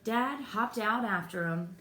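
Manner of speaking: usual speaking voice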